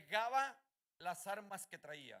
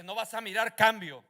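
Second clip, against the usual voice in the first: firm, louder devil taunt; clear voice; 14 dB louder than usual. Retold or imitated. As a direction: say it flatly and firmly. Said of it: "Con una voz clara". (voz clara y firme del enemigo)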